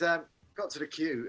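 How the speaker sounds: normal talking voice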